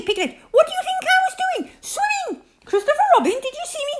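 very high, breathless-with-pride chatter; excited squeak. A narrator's reading. Roo boasts to each friend about swimming, squeaking excitedly. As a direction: squeal at everyone for attention. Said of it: "squeaked Roo excitedly".

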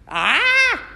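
high, loud "aah"; answering yell. A narrator's reading. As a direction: yell right back at the monster. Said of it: "Kipper yelled back".

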